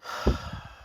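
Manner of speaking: long breathy exhale; real exasperated sigh; weary sigh. Real, not acted. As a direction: let out a fed-up sigh. Fed up with the show.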